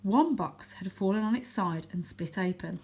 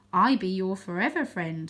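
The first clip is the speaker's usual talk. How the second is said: usual speaking voice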